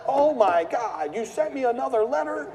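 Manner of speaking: high, loud, slow exasperated squawk; mock outraged seller. Seller yelling at the investor about another letter, mock outrage. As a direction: play the fed-up homeowner in disbelief.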